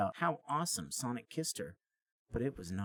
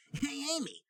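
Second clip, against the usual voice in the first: much higher cocky greeting; Sonic flirting. Acted. Sonic showing off to Amy, high and cocky. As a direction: show off to her. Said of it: "said Sonic".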